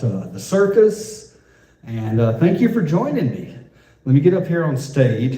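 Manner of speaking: loud, booming radio DJ host. Pretend. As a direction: announce it like a DJ opening the show on the mic. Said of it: "That was my D J voice".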